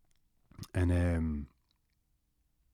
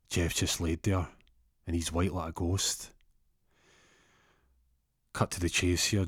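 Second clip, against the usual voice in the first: normal talking voice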